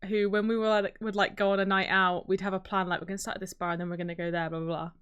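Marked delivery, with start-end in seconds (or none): none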